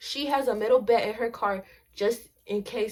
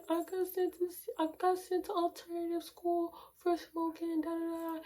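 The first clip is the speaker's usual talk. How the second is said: high-pitched imitation of crying on the phone; softer, high mock-crying voice; mock tearful; 8 semitones higher, 5 dB quieter than usual. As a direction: pretend to confess through tears, much higher than usual. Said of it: "and I was crying".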